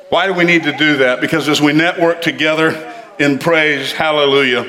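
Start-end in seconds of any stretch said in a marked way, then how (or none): none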